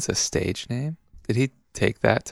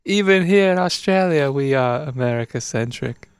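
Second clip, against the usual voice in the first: put-on Australian accent; 7 semitones higher than usual. Aussie chatting about toilets, mock Australian accent. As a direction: ham up an Aussie musing on toilets. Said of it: "Been working on my Australian accent".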